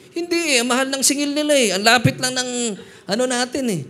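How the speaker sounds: high, loud indignant haggling; arguing about fare. Retold or imitated. Dad arguing with tricycle driver, heated. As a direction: complain hotly about the fare.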